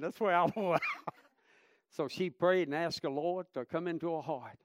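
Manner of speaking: ordinary delivery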